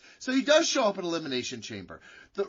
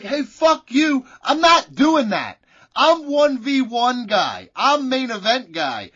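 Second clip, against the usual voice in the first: louder, slow hammed-up shouting refusal; mock angry Roman; 2 semitones higher, 8 dB louder, slower than usual. Roman Reigns refusing the Elimination Chamber match, mock angry shout. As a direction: bellow it like a furious wrestler, mock outrage.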